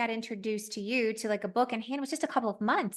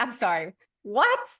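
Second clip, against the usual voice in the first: rising, louder disbelief, higher and higher; stunned wife, each what climbing higher; incredulous disbelief; 5 semitones higher, 4 dB louder than usual. Retold or imitated. Wife hears husband wrote book.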